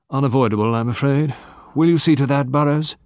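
a hint of an official taking charge; elderly lawyer. A narrator's reading. Legal man instructing Burrows about police, lightly voiced, firm.

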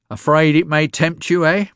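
put-on old woman taunting the lawyer about her face; mocking crone. A narrator's reading.

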